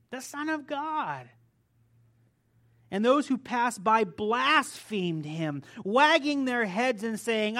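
lauter, eindringlicher Predigtton; preaching at full force. Acted. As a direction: proclaim the scripture loudly to the congregation. Reads the crucifixion passage aloud.

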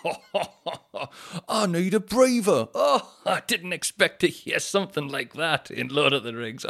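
high, loud, laughing audience member; hammed-up laughing fan; mock cinema laughter. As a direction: cackle like a delighted cinema crowd. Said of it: "and everyone's like".

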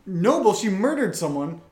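ordinary talk

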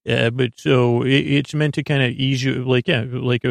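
ordinary delivery

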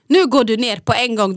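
slow, firm retold command; stern order. Mum after asking nicely several times, stern order. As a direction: lay down the law, word by word.

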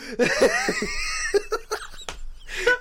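high, hard laugh; cackling laugh. Real, not acted. Laughing at the scene.

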